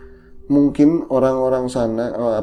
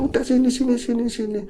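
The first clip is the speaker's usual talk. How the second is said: pitched high, hurried beckoning; voicing the grandma; 11 semitones higher than usual. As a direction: urge the boy over quickly. Grandma beckons in retold story. (nenek memanggil cepat dengan suara tinggi)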